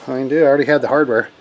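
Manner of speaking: ordinary delivery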